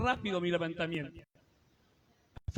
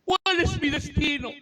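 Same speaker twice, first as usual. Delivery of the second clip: higher, louder pleading prayer, slowing; kneeling prayer; 7 semitones higher, 6 dB louder, slower than usual. Retold or imitated. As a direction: cry out to God at midnight on his knees. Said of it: "doblada mi rodilla". (oración suplicante de rodillas, más alta)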